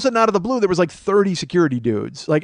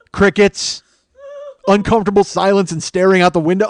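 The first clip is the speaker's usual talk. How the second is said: usual speaking voice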